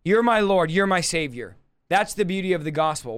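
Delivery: ordinary talk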